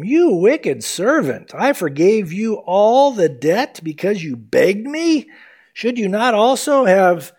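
loud, slow, high condemnation of the servant; stern rebuke. Acted. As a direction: thunder it down like a wronged master. Master rebukes the wicked servant.

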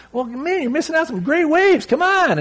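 high, coaxing call; friend calling. Retold or imitated. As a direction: urge him to come catch waves. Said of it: "And he finally is like".

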